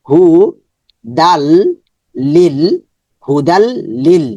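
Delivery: ordinary delivery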